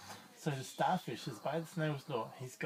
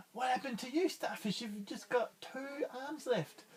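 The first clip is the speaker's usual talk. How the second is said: higher gasping surprise; higher startled snail voice; shocked snail; 8 semitones higher than usual. Acted. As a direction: gasp then ask with alarm. Snail sees injured starfish.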